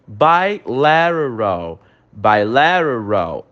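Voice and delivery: slow bilateral with a tapped T; careful English pronunciation. Shown as a demonstration. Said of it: "a pronúncia dessa frase é um pouco complicada". (bilateral devagar, com o T soando como R)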